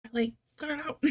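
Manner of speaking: high playful put-on scolding voice; mock scolding. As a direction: tease him to cut it out, hamming it up. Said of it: "and I'm like".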